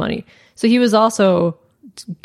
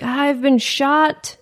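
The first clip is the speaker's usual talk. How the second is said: victim voice; 7 semitones higher, much slower than usual. Retold or imitated. Retelling 911 call quote.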